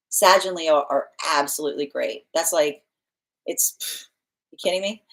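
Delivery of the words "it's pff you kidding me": ordinary talk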